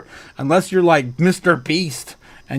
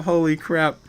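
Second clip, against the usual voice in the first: ordinary talk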